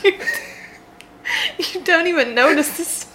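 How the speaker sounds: words broken up by laughter; laughing. Real, not acted. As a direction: laugh through the narration.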